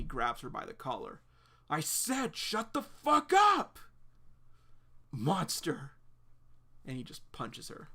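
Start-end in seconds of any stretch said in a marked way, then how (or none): 1.7-5.9 high, slow, drawn-out furious scream; screaming rage. Acted. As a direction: stretch every word out in a rage. John screaming at Claire.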